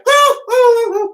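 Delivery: high, loud pretend-bashful oohs; hammed-up coy squeals of feigned shock; mock coy "ah". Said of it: "but I had to pretend".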